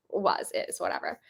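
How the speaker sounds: ordinary talk, nothing marked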